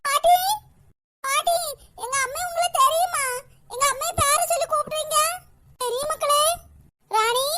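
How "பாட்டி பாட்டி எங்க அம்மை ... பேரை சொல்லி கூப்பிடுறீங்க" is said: put-on high small girl's voice, puzzled; young girl. Girl asks the old woman if she knows her mother, childlike. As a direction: pitch it up like a wondering child.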